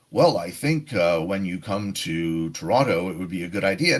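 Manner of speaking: low, stiff and formal; the wrong way to answer a friend, stiff; stiff formal reply. Shown as a demonstration. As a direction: sound like a robotic formal answer. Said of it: "So it's not".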